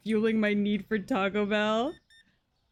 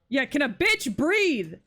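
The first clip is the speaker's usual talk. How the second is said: exasperated; 6 semitones higher, 4 dB louder than usual. Real, not acted. Overwhelmed by rush of customers.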